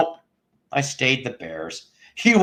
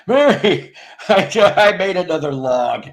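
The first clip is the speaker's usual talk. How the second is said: high and loud, put-on Lincoln voice announcing it; hammed-up Lincoln proud of his log; mock Abe Lincoln; 6 semitones higher, 5 dB louder than usual. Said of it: "I don't know why he talked like that".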